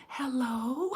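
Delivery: sudden sweet phone voice, imitated; mom answering phone. Mom picks up the phone mid-scolding, imitated polite hello. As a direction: switch from yelling to a composed hello. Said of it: "then the phone would ring".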